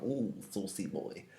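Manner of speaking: mock flirtatious purr; flirty saucy. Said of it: "He doesn't mean that in, like".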